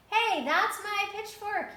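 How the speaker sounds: high, loud, slow angry shout; farmer shouting. A narrator's reading. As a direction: claim it back across the crowd. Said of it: "he shouted".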